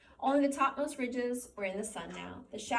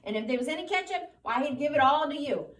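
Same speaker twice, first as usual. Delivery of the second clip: higher, louder scolding aunt; 5 semitones higher, 8 dB louder than usual. A narrator's reading. Aunt Clara keeps scolding Lenny, high loud scolding. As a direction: scold him without letup. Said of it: "she went on in Lenny's voice".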